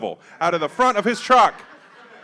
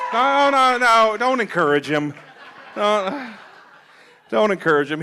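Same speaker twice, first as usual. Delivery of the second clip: stop cheering; 5 semitones higher, 3 dB louder than usual. Real, not acted. Telling audience stop cheering.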